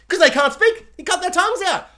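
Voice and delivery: playful retort; mock exclaim. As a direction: blurt the punchline. Mute men's imagined answer.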